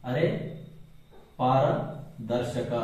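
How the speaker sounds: ಅರೆ, pause, then ಪಾರದರ್ಶಕ very slowly; slow dictation. Shown as a demonstration. Teacher dictating term to write.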